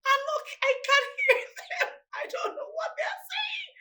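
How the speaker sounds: high mock voice of a puzzled mother; hint of the mother, high and strained; imitating mom. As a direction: play the mother who can't follow the kids' accents. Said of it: "my mom would say".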